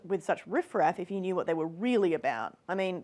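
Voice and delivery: normal talking voice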